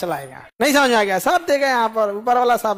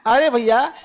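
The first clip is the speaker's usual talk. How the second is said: ordinary talk, nothing marked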